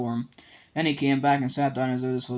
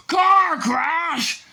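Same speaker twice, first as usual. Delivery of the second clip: huge furious roar, much higher and louder; 8 dB louder than usual. A narrator's reading. Hagrid roars at the Dursleys over the lie. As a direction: bellow it in outrage. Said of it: "roared Hagrid".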